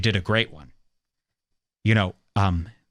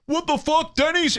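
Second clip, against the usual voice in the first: mock outrage; 13 semitones higher than usual.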